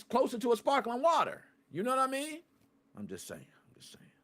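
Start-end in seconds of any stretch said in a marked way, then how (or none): 3.5-4.1 whispered aside. Real, not acted. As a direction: breathe it out under your breath. Repeats the line, then whispers it.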